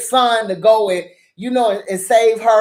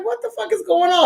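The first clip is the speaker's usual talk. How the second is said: disbelieving outburst; 9 semitones higher than usual. Retold or imitated. Reacting in disbelief.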